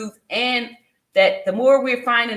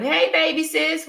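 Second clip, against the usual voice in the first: normal talking voice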